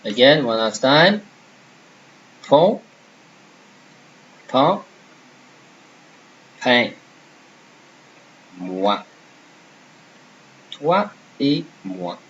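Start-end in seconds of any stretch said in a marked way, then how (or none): 2.4-6.9 "Pont", "pain", "pain" with three nasal vowels; teacher models "Pont", "pain", "pain"; French drill. Shown as a demonstration. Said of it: "let's say a few words again". 10.7-12.2 "Toi et moi" slowly as twa e mwa; slow French. Shown as a demonstration. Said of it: "let's say a few words again".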